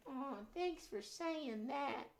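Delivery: high, slow, timid critter voice; shy little muskrat voice, drawn out; high timid puppet muskrat; nervous puppet. Acted. Puppet Gus speaks.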